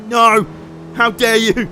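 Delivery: high, loud mock outrage at the rival; outraged car voice. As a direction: play the car as scandalised and furious. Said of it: "R X seven saw what happened and was like".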